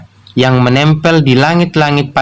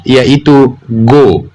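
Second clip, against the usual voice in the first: ordinary talk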